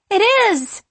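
high, loud squeal of agreement; delighted. A narrator's reading. Sarah tasting the water, squealing delightedly. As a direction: squeal it, thrilled.

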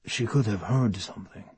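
soft and tentative, almost whispered; hushed, apologetic, unsure. A narrator's reading. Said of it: "a soft whisper, apologetic and tentative".

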